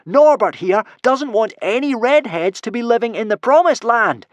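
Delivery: high, loud accusing yell. A narrator's reading. Hiccup warning the redheads about Norbert, high yell. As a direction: yell it out to the whole crowd. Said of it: "yelled Hiccup".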